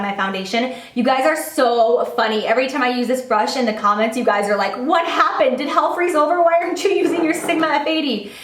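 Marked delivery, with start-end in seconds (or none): 4.8-8.3 higher, lightly mock-astonished questions; mock shocked viewers. Voicing commenters shocked she switched brushes, mock-astonished. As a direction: play the baffled fan in the comments.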